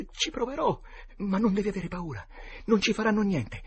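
put-on very high, tense boy voice; anxious reassurance. Boy promising to pray and calming his friend, anxiously. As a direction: reassure while sounding scared yourself.

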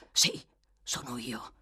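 a touch of gruff impatience; harsh interruption. A narrator's reading. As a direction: cut in with a rough edge. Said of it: "l'interruppe l'uomo in tono aspro".

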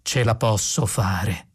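low, slow measured voice; grave thinking machine; ponderous computer. A narrator's reading. Computer affirms it can do it.

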